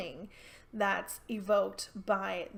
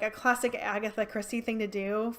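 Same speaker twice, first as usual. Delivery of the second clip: usual speaking voice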